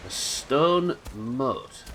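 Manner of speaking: usual speaking voice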